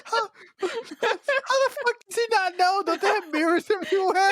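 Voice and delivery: high, squeaky laughing question; laughing disbelief. Real, not acted. Podcast host laughing at the mole joke, high and squeaky. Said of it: "laughing disbelief".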